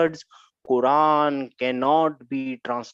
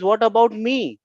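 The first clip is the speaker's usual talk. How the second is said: higher, louder plea to be included; protesting Lam; 6 semitones higher, 6 dB louder than usual. Acted. Lam asks Inna about himself, protesting loud and high. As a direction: call out for attention in a raised voice. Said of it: "Now Lam says".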